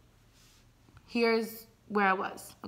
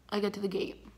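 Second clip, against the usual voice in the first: ordinary delivery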